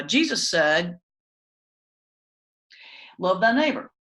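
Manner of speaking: preacher demos the table fan to pastors, a stiff sweeping recital; table fan preaching. Shown as a demonstration. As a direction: demo of bad preaching, lines flung out to the room. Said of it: "what I call the table fan".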